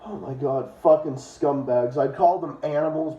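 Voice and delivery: ordinary delivery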